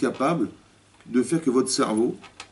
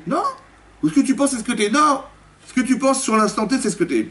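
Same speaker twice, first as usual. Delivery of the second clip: emphatic protest; 12 semitones higher than usual. Real, not acted. Rabbi correcting his listeners, much higher and insistent. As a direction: cry out to correct them.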